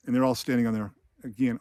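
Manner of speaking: usual speaking voice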